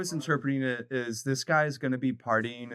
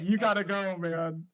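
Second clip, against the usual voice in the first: mock dad; 4 semitones higher than usual. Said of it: "the dad's like".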